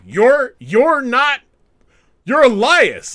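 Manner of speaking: mock-outraged insistence; exasperated. Kevin Owens insisting to Ezekiel, exasperated and loud. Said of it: "Kevin Owens like".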